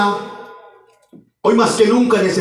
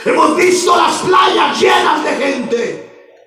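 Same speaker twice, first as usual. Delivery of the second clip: shouted revival sermon, phrases punched out; full-force preaching; 7 semitones higher than usual. Real, not acted. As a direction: cry out to the congregation like a revival preacher. (predicación a gritos, con toda la fuerza)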